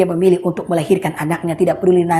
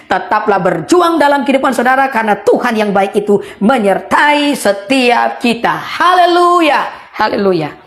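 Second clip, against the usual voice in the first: rising preaching; 4 semitones higher, slower than usual. Real, not acted. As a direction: lift the congregation to a shout of praise. Preacher's climax exhortation. (berseru penuh semangat)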